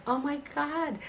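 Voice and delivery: high astonished exclamation; mock-stunned gasp of disbelief; retold amazement. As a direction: echo her shock, pitched well up. Her reaction retold.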